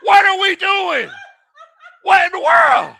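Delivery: high, loud outraged yelling; exasperated shout. Real, not acted. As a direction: yell at the coaching decision in disbelief. Outraged at the two-point try.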